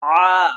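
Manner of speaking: ع- pushed hard from the throat, loud; loud guttural ع- demo; throat letter ain. Shown as a demonstration. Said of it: "یہاں سے نکلے گا".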